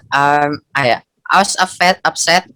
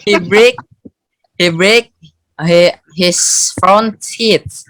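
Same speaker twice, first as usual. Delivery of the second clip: slow it down, pausing between each phrase; slow, careful repeat; 2 semitones higher, slower than usual. Real, not acted. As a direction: repeat it piece by piece for a confused listener. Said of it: "you can repeat that".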